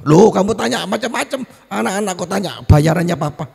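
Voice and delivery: indignant, high-pitched outburst; acted parental outrage; angry father. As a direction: scold the child, voice jumping up high. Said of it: "papanya biasanya kalau ditanya marah".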